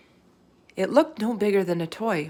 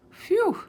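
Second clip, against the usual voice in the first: relieved phew. A narrator's reading. Relief at no dragon.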